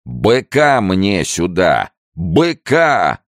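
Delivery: put-on slow, high drunken roar. A narrator's reading. Drunk gendarme demands a bull, roaring. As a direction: bellow it out, stretching each word. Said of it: "заревел".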